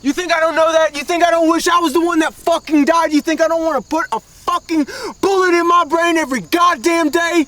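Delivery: screams his guilt, voice cracking with rage; furious, despairing shout; anguished screaming. Acted. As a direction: hurl it back at the top of your lungs. Guilt-ridden outburst in a quarrel.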